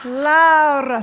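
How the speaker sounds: "Laura" with a trilled, rolled R, loud; loud "Laura" with a Spanish-style trilled R. Shown as a demonstration. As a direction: roll the R in "Laura" proudly. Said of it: "it has to roll the R".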